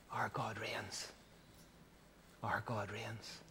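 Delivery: hushed, very low and soft; awed whisper. Acted. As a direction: whisper it as a private, reverent thought. Angels' hushed thought at the resurrection.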